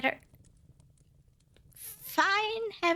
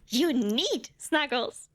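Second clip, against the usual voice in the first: slow, weighted insistence; correcting herself with slow insistence; insistent; much slower than usual. Acted. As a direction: lean on each word, slowly and firmly.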